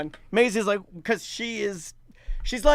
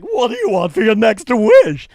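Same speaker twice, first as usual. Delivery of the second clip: booming genie asking for a wish; loud, booming magic genie; 2 semitones higher, 7 dB louder than usual. Pretend. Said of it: "That's my genie voice".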